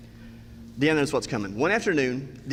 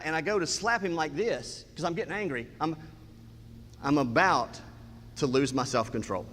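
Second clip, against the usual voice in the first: normal talking voice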